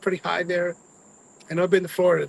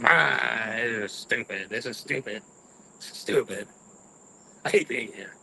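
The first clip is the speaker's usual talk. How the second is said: a touch of low, sour grumbling; salty grumbling; 5 semitones lower than usual. Retold or imitated. Driving the rental car, a low sulky grumble. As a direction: moan it under your breath. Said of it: "And I'm all salty".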